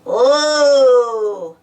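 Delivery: loud, drawn-out ghostly "oooh"; spooky wail. A narrator's reading. Spud teasing Muck, spooky ghost noise. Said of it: "Spud made a spooky noise".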